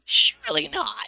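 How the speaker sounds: slow high doubtful scoff; disbelieving. Acted. GM voices disbelief.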